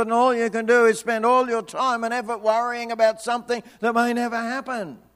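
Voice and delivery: usual speaking voice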